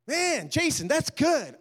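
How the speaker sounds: high, loud burst of relief; overjoyed relief. Retold or imitated. Forgiven man thanks Jason, bursting with high loud relief. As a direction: let the relief break out.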